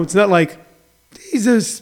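usual speaking voice

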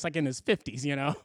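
ordinary delivery

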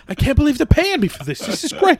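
high, fast gleeful voice; gleeful mock voice. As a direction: gloat with childish delight. Boxer mocked as gleeful.

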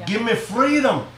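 a lightly done Scottish Braveheart line; Braveheart Scottish accent. Pretend. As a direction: friends ask for his London accent, he gives mock Scottish Braveheart. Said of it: "you want me to do it?".

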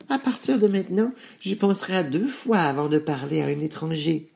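put-on high little-girl voice; high-pitched child talking to herself. A narrator's reading. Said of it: "se dit le pte-, petit chaperon rouge".

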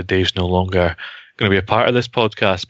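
ordinary talk

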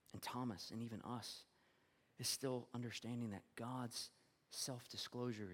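quiet, weighted sermon voice; hushed reflective preaching. Acted. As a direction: bring the congregation down to near whisper.

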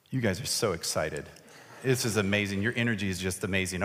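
low, dry mock praise; unimpressed deadpan compliment; deadpan sarcasm. Real, not acted. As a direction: tease them with understated, low-pitched praise. Teases volunteers' lack of enthusiasm.

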